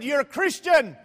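loud, high accusing shout; mock drunk stranger. Stranger challenging preacher's faith, loud mock drunk. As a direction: accuse like a rowdy street heckler, acted out. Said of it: "I got a drunk on my hand here".

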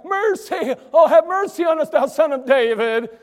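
loud, high pleading cry; crying out for mercy. Acted. Blind men calling to Jesus from the roadside, loud pleading. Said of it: "and they're crying".

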